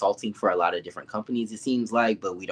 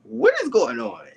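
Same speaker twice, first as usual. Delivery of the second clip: mock shock; 10 semitones higher than usual. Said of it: "I said".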